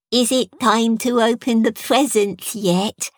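put-on slow, high, lisping child; an adorable small boy lisping; lisping little boy. A narrator's reading. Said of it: "he lisped adorably".

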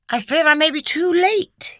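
high, theatrical mock gravity; mock seriousness. A narrator's reading. Doctor jokes with Lucy on the doorstep, mock serious. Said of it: "he said with mock seriousness".

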